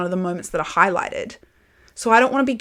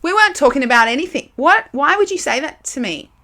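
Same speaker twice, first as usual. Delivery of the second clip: high, loud, disbelieving sorry what; incredulous outburst at a rude remark; retold stunned reply to a tactless friend; shocked indignation; 6 semitones higher, 6 dB louder than usual. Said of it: "And I was like".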